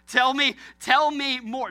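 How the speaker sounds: mock eager plea. Husband faking interest to his wife, loud and slow. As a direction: ham up the fake fascination. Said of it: "I'm like".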